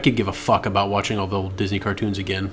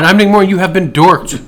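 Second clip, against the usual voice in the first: announcer; 8 semitones higher, 8 dB louder than usual. Acted. Show sign-off announcement.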